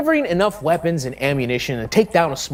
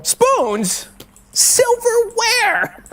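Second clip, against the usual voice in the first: high, drawn-out call; much slower than usual. Pretend. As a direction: stretch the last word out high. Indignantly calls out for cutlery.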